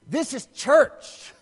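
THIS IS CHURCH, loud and slow; emphatic realization. Retold or imitated. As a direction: land loudly and slowly on CHURCH. Preacher voices his awed realization.

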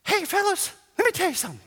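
very high cutesy cherub voice; mock cherub greeting the fellas, very high; little cherub voice. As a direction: play a tiny chubby angel chirping at the guys. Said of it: "That's how he talks".

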